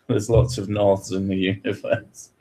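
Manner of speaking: ordinary delivery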